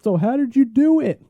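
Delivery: high, loud mocking whine; mocking whiny voice. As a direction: mimic a whiny questioner. Mocking fat people asking.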